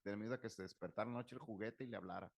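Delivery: ordinary talk, nothing marked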